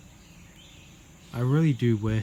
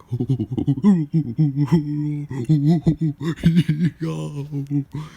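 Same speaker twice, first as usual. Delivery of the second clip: long, loud imitated ape hoots; ape hooting; 3 semitones higher, 6 dB louder than usual. Storyteller being the kicked ape, loud hooting. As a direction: hoot like an agitated ape, building to a cry.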